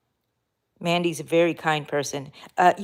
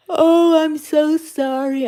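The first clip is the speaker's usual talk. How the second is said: talking through a yawn; 8 semitones higher, 5 dB louder, slower than usual. Real, not acted. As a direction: say it mid-yawn. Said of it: "I'm yawning".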